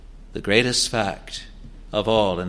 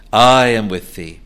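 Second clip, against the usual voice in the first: "I" hit much louder; stress on I; 7 dB louder than usual. Shown as a demonstration. Preacher repeats God's promise, stress on "I". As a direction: punch "I", then ease off. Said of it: "The, the emphasis is upon "I".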